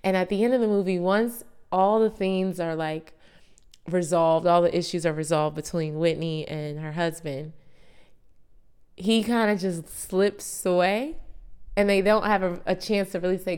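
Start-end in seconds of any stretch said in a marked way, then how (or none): none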